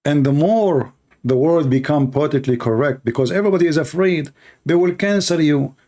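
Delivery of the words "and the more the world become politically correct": ordinary talk, nothing marked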